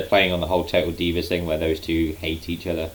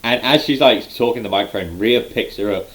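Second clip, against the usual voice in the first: ordinary talk, nothing marked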